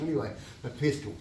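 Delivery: usual speaking voice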